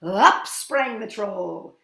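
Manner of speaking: very loud, drawn-out narration; big storybook jump-scare; dramatic sudden leap. A narrator's reading. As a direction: burst out on the first word and stretch it. Troll leaps up in story.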